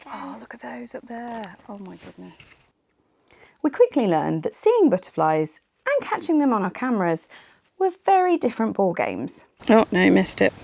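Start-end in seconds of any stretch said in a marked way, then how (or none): 0.1-2.5 awed, hushed whisper. Real, not acted. Hushed at butterflies above. 9.7-10.5 frustrated. Real, not acted. Missed the butterfly shot.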